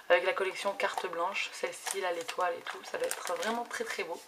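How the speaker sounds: ordinary delivery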